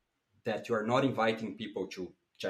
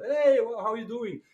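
greeting friend; 11 semitones higher, 6 dB louder than usual. Retold or imitated. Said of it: "you say your friend".

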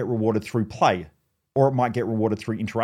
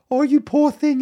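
high, cooing pet-owner sympathy; mock pitying coo; 4 dB louder than usual. Owner to the sad-faced dog, mock pitying coo. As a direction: coo over the dog in a raised voice.

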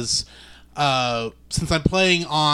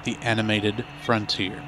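Star Trek narrator; 4 semitones lower, 5 dB quieter than usual. Acted. Star Trek intro parody.

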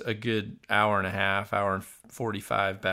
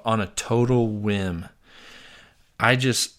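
ordinary delivery

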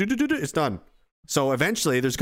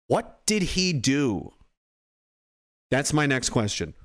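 usual speaking voice

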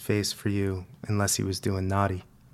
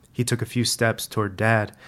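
usual speaking voice

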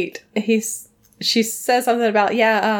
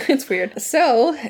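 ordinary delivery

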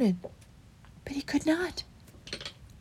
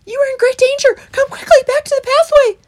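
very high, loud, urgent mouse; 13 dB louder than usual. A narrator's reading. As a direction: squeak an urgent warning. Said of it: "he panted".

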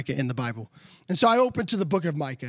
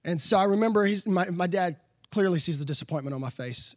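ordinary talk, nothing marked

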